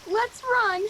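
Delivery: loud, frightened urge to run; urgent cry. Acted. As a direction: blurt it out in a panic. Frightened girl urging flight.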